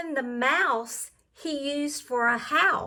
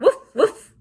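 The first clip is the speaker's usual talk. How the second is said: louder woof like a daunted dog; barking; 2 semitones higher, 6 dB louder than usual. Acted. Voicing the scared dog, a playful mock bark. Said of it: "who barked".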